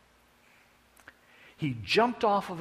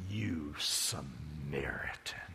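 slow, low contemptuous whisper; sneering whisper, low and slow; whispered insult; 11 semitones lower, 7 dB quieter, much slower than usual. Acted. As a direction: breathe it out as the worst slur.

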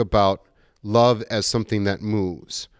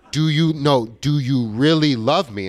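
higher, louder, pressing the question; husband begging his wife for an answer, louder and higher; insistent pleading; 6 semitones higher, 5 dB louder than usual. Retold or imitated. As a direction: push harder, needy and insistent. Pushing wife a third time.

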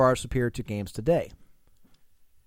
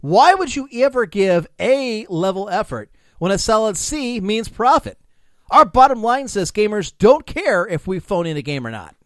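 louder, rising smug tirade; escalating comedy rant; 6 semitones higher, 5 dB louder than usual. Acted. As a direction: build the gloating rant. Fake quote builds to climax.

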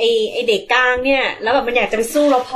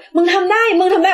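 higher, louder chant of encouragement; mock cheering; 7 semitones higher, 3 dB louder than usual. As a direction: cheer a fallen mate on. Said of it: "ทุกคนก็เชียร์".

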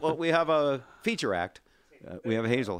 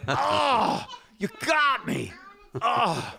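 mock surprise; 11 semitones higher, much slower than usual. Fake scare at joke punchline.

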